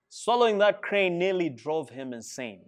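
ordinary delivery, nothing marked

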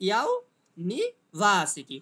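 "University" very slow and loud, NI unstressed, VA hit hardest; exaggerated syllable stress. Shown as a demonstration. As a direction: stretch "university", pausing between parts, full force on VA. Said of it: "তার উচ্চারণ হবে কেমন?".

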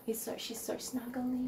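very soft, nearly whispered; hushed. Real, not acted. Groomer cuddling a snuggly dog, hushed and tender. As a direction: murmur it tenderly, almost without voice.

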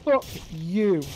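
angry curse. Real, not acted. Cursing game enemy.

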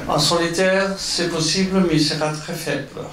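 Spanish-accented French; foreign accent in french. Real, not acted. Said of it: "mon français c'est toujours bon".

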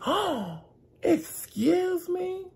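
imitating an affronted great-grandmother, high and slow; high, offended old lady. Great-grandmother answering the waiter, in a high scandalized mock cry.

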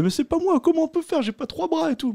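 high whiny mock protest; protesting cellmate. Neige voiced protesting innocence. (protestation geignarde, voix très aiguë)

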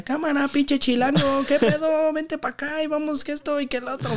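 mock the new kid from the other city; mocking teammate. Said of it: "Me decía". (burla de compañero, voz mucho más aguda)

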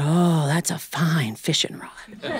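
slow, reverent whisper over the rod; hushed admiring whisper. Retold or imitated. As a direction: breathe it out like it's treasure. Uncle admires the new rod.

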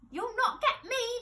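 high, defiant piglet voice; crying out, pig. A narrator's reading. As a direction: taunt in a squeaky pig voice. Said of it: "the piggy cried".

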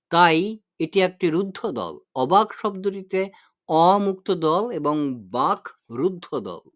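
usual speaking voice

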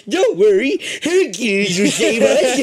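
put-on old man voice. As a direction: quaver it like a hopeful old man. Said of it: "It's my old man voice".

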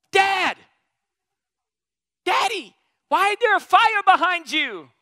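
put-on high, loud excited child voice; imitating a little boy crying out to his dad; child calling out. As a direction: shout it like a startled little boy. Child calls out in story.

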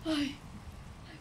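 normal talking voice

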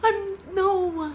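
high, loud anguished wail. Real, not acted. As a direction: cry out in real heartbreak. Reacting to heartbreaking lyric.

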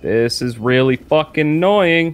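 frustrated. Real, not acted. Frustrated at a game scare.

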